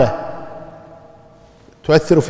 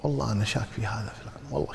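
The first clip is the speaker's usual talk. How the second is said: doubtful aside; 6 semitones lower, 17 dB quieter, faster than usual. Retold or imitated. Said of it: "المتهم بالكذب شاكين فيه".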